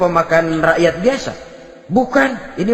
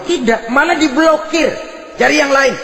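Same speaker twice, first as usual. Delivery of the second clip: emphatic shout; 7 semitones higher than usual. Acted. Emphatic punchline, shout heard.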